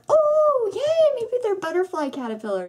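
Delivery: very high, bright hopeful gush; high excited oh yay; mock delighted squeal. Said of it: "and I was like".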